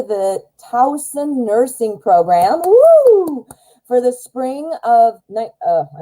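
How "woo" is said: high, loud whoop; excited cheer. Real, not acted. Cheering a nursing question.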